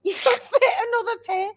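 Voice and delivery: high, crying with laughter; laughing helplessly through the words. Real, not acted. Telling hosts about the huge top, crying with laughter.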